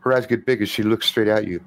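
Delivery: normal talking voice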